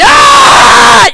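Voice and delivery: very high, blasting yell; demonstrative scream. Shown as a demonstration. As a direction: scream to prove how loud you are. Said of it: "You see what I mean?".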